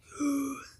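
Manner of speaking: gulping air to burp; quick gasp swallowing air; sharp air gulp. Shown as a demonstration. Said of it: "I have this trick where I go".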